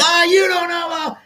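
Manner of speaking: mock argumentative outburst, pitched high. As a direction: imitate opinionated family talking over each other. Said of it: "and we're opinionated".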